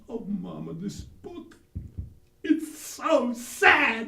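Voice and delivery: put-on high, slow little boy wailing; child's voice. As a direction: whine it like a sad little boy. Little Newt says the book is sad.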